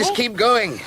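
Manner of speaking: high, loud, insistent push; forceful urging. Acted. Man driving exhausted companion toward the light, insistent. Urges again, more forcefully.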